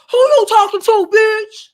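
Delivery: loud, high-pitched confrontational shout; fighting challenge. Acted. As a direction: yell it like you're starting a fight. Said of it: "it wasn't on some, "I'ma fight you".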